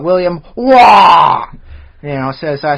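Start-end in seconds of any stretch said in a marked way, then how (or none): 0.0-1.5 slow, booming shout of the name; loud aggressive name. Acted. Host reading out a listener's surname as a slow, booming shout. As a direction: bellow the surname, drawing it out. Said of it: "fun surnames I like to pronounce very loud and aggressively".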